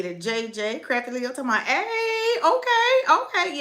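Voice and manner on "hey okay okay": high-pitched hyped-up cheering; playful high whoop, dropping slightly at the end; excited quote. Retold or imitated. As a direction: read it out as a squealed hype-up. Voicing an excited chat message.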